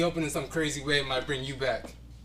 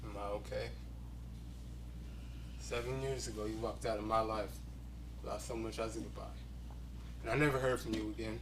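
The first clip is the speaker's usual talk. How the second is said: much softer, low and held back; bitter quiet; 5 semitones lower, 11 dB quieter than usual. Acted. Man answering the woman who left him, quiet and bitter. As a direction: hold it down, quiet and wounded. Confronting an old love.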